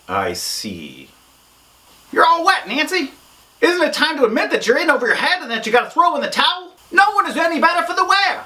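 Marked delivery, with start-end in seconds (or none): 2.1-8.4 high-pitched heckling character voice; taunting critic of Nancy. Acted. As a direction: needle the negotiator in a high voice.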